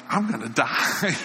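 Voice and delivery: laughing mock panic. Pastor imagines fiery coal approaching.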